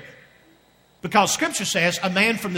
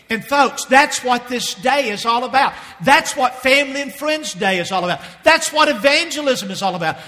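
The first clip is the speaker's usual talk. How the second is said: rising, louder preaching cadence, building line by line; full-force preaching; 6 semitones higher, 4 dB louder than usual. Acted. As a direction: drive it home like a revival preacher. Preaching climax.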